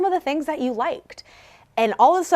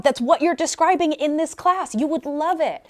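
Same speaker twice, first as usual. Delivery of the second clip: ordinary delivery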